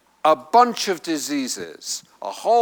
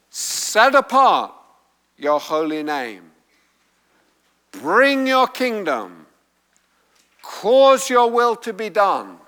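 strong imperatives; 3 semitones higher, 3 dB louder than usual. Shown as a demonstration. Preacher showing the congregation the Greek prayer's force, strong imperatives. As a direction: press each petition out as a command. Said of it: "it's all strong imperatives".

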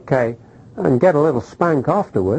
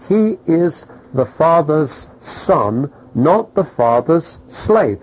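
slow emphatic contrast; slower than usual. Acted.

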